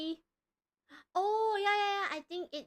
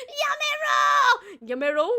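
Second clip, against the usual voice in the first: loud protest; 12 semitones higher, 13 dB louder than usual. Real, not acted. Begging chat to stop.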